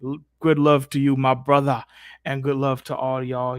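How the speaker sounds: usual speaking voice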